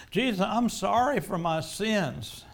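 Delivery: fervent penitent outcry; pleading cry. Acted. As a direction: cry out to Jesus in repentance.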